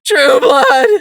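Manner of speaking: very high, loud anguished wail. Acted. Sunflower crying out for her husband, wailing. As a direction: wail his name in despair. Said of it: "she wailed".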